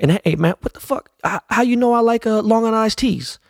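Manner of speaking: hammed-up high Dre voice. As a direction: pitch way up, playful mimic of Dre asking. Dre's voice in retold meeting.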